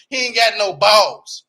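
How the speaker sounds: slow, loud, every word hit; emphatic. Real, not acted. Roasting the dad to viewers, emphatic. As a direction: lay it down slow and heavy. Blunt insult of the father.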